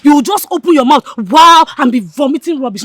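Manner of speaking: loud, contemptuous tirade; scornful scolding. Acted. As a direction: tear into your friend loudly. Berates friend for speaking up.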